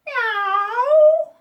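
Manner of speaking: very loud high yowling meow; cat meow. A narrator's reading. Cat in the basket yowling to get out. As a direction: yowl like a trapped cat.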